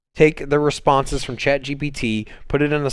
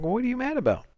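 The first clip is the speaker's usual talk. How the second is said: imitating the chatbot; 11 semitones higher, 5 dB quieter, faster than usual. Acting a chat with the AI.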